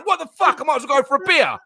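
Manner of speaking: high-pitched imitation of exasperated fans. Imitating fans fed up with a five-minute hold-up, exasperated. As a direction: mimic fans losing their minds. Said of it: "fans losing their minds".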